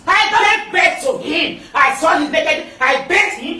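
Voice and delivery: ordinary talk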